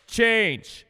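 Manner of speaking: "Change" jumped up high with a falling snap; sharp "Change". Retold or imitated. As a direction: bark the word "Change" to cut off the old you. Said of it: "He goes, like".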